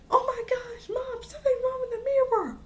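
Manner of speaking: high-pitched squeal of alarm, acted out; mock shocked cry. Girl calls her mom about the mirror, mock shocked cry.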